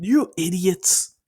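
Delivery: high, loud, slow shout of idiot; fond angry yell. Retold or imitated. Bakugo yells at his friends, loud and slow. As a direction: scold them with a loud grin. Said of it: "he smiles like".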